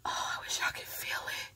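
awed hushed whisper. Real, not acted. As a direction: breathe it out in wonder. Whispering in awe.

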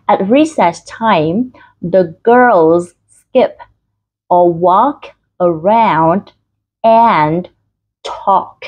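very slow, over-articulated drill reading; slow, word by word. Shown as a demonstration. Teacher has learners feel lips and jaw move, slow exaggerated articulation. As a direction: stretch each word with big mouth movement. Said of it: "đọc chậm cho mình từng từ".

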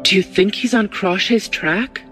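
horrified. A narrator's reading. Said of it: "I stood still with horror".

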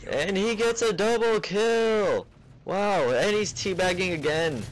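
high, loud mock play-by-play in a fake accent; hammed-up excited game commentary; excited commentator. Mock commentary on a double kill.

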